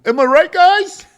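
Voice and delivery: high, slow stadium-singer shout to the crowd; mock rock frontman. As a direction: play the cheesy lead singer hyping the crowd. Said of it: "just been like".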